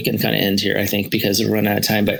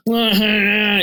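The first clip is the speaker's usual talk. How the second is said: mock child's wail, pitched high; imitated crying; 10 semitones higher, much slower than usual. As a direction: wail like a whining kid, imitating. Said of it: "and then she's crying".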